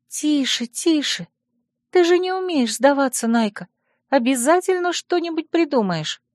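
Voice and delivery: high, hushing comfort; soothing friend. Acted. Friend hugs and calms the upset heroine, soothingly.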